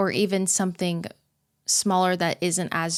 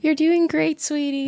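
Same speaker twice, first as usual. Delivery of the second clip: sweet mock praise; 9 semitones higher than usual. Teasing echo of the praise.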